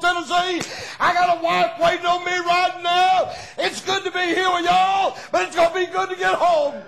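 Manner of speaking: loud, high-pitched preacher's cry; preaching at full force. Acted. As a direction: lift the church with a shouted testimony.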